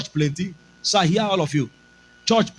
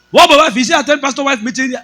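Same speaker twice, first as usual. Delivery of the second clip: loud, higher demand; demanding boss; 8 semitones higher, 6 dB louder, much faster than usual. Retold or imitated. Boss questioning the pastor about his wife, loud demanding. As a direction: press him with questions.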